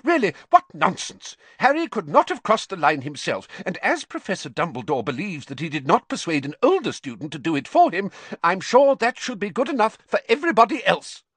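high, indignant outburst, loud at both ends; angry. A narrator's reading. McGonagall defending Harry before everybody, angry. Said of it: "She shot a very angry look".

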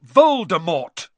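forceful one-word outburst; bellow. A narrator's reading. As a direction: bellow the name defiantly. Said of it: "Harry bellowed".

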